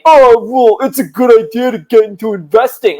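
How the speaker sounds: high, dopey mocking voice; moron dad voice. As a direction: make dad's remark sound utterly moronic. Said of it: "that's how she talks about my dad".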